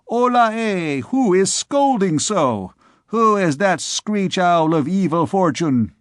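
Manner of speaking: lightly voiced jeering student; mocking jeer. A narrator's reading. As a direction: taunt the old man. Students mock the scolder.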